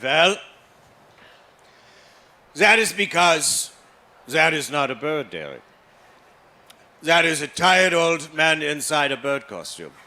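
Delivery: a father deflating his son's dream, mock stern; stern German dad. Father answers about Big Bird.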